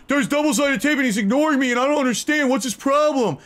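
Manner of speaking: high, loud mock whining about being ignored; whiny mock chatter. Shown as a demonstration. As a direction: whine it like a wronged fan.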